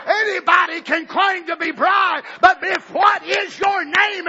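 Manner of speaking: usual speaking voice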